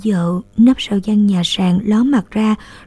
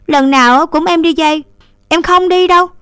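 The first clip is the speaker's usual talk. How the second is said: higher, louder pouty no; sulky refusal; 8 semitones higher, 5 dB louder than usual. A narrator's reading. Little sister refusing to borrow rice, sulky. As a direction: refuse like a spoilt child. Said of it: "Nương ngúng nguẩy".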